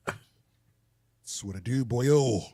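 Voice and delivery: put-on lousy Irish accent. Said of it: "lousy ass Irish accent".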